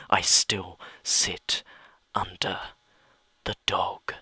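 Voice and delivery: usual speaking voice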